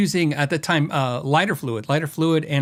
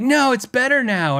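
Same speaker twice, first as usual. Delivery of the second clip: mock insistence; 9 semitones higher, 3 dB louder than usual. Acting out customer defending his work.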